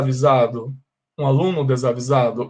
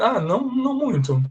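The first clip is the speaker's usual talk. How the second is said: high casual reply; 8 semitones higher than usual. Acted. Acted friend's offhand answer.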